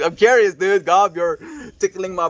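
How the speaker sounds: bursting out loud and high with eager curiosity; excited. Real, not acted. Streamer rereads the curious chat, loud and eager. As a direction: shout it like you can't wait to know.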